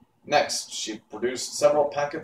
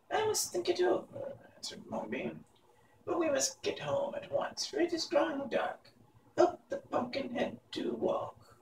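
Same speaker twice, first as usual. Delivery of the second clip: high and soft witch muttering; an old witch hurrying them home; 7 dB quieter than usual. Acted. Witch Mombi ordering boy Tip home, high and soft.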